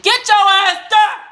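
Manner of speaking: loud, slow, high yell at someone in the room; real shout. Real, not acted. Mid-story, yelling at the dog off camera, a loud shout. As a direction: bark it across the room to get them moving.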